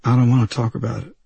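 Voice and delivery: barely holding himself together; weak voice. A narrator's reading. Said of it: "he said in a weak voice".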